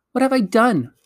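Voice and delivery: put-on high-pitched, indignant boy; indignant protest. A narrator's reading. Digory answering Polly's demand for an apology, indignant. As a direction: protest your innocence, pitch jumping right up.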